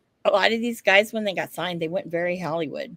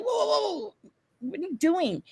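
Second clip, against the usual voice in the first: mock-startled objection, pitched way up; startled protest; 10 semitones higher, 4 dB quieter than usual. Acts out reaction to phone snatch.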